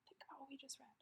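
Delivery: hushed teacher whisper. Shown as a demonstration. Teacher whispering to the class to think. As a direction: whisper it to draw them in.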